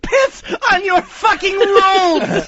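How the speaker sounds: high sobbing mock outburst; crying shout. Inmate wailing at the nurse, high crying rant. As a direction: scream it like an inmate losing it. Acting out mental ward scene.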